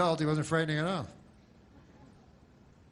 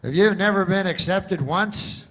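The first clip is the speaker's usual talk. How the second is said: pushy insurance pitchman; 6 semitones higher, 7 dB louder than usual. Retold or imitated. Insurance ad voice pitching old people, loud and pushy. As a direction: ham up a cheesy TV insurance ad. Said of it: "so it's always like".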